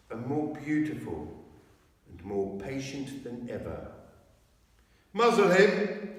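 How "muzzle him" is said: high, loud witch's order; loud cruel witch command. A narrator's reading. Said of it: "said the witch".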